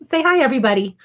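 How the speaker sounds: ordinary talk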